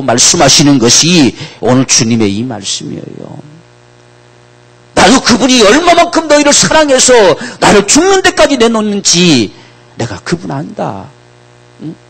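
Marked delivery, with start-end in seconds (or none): 4.9-9.5 very high, loud impassioned voice; fervent preaching. Acted. Preacher speaks as Jesus about the Father's love, very high and loud. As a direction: proclaim with breaking intensity. Voicing Jesus at full force.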